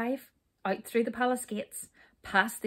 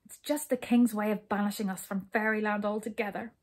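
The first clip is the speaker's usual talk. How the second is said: ordinary delivery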